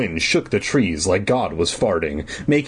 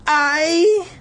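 put-on very high, strained little-girl stammer; 3 dB louder than usual. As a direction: squeak it out and break off. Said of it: "Alicia stammered".

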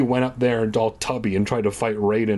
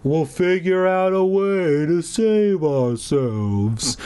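slow, higher, lumbering fat man voice; fat guy voice; 5 semitones higher, slower than usual. Pretend. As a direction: drag every word out heavily. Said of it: "'Cause apparently that's how fat people talk".

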